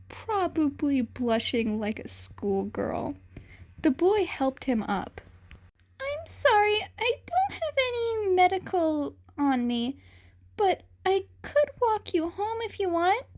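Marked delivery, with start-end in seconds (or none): 6.0-13.2 put-on high, bright young hero voice, concerned; cat boy voice. A narrator's reading. Cat boy checks on fallen Shinso, high and kind. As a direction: ask gently from up high.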